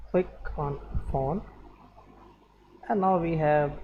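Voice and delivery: usual speaking voice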